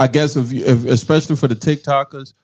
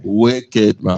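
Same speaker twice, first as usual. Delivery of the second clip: Jamaican accent; 7 semitones lower than usual. Shown as a demonstration. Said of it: "saying that with my Jamaican accent".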